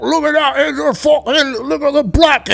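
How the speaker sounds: very high caricatured British bigot. Acted. Mocking British racism, grotesque caricature voice. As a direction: push it up into a strained mock voice.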